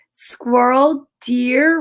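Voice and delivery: ordinary delivery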